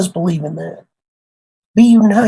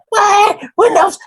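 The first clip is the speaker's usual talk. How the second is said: squeaky, much higher mock outrage at the word Windows; mock disbelief. Mocking the senior tech's shocked squeal over Windows. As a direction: parody a tech who can't believe it's Windows. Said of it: "he's like".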